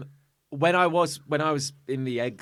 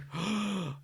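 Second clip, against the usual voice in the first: dramatic gasp; 6 semitones higher, 8 dB quieter than usual. Retold or imitated. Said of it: "he just goes like".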